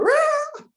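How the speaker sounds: very high, loud mock cheer from the crowd; imitating the family's welcome yell; a happy scream of greeting; joyful shout.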